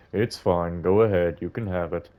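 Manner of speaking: put-on old man. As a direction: speak as a gentle elderly gentleman. Voices old man character.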